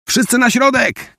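high, urgent commanding shout. A narrator's reading. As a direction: order everyone to the middle. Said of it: "krzyknęła". (wysoki, naglący okrzyk rozkazu)

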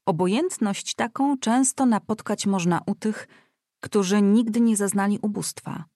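plain reading voice

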